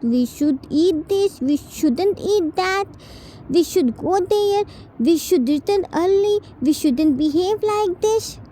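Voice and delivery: put-on high, defiant ten-year-old yelling back; bratty boy voice. Spoiled ten-year-old T defying his brother-in-law, bratty high voice. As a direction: sneer it like a spoiled child.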